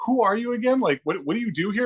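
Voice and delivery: high-pitched mock puzzled coworkers. Coworkers questioning the unknown intern, high mock bafflement. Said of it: "they're just like".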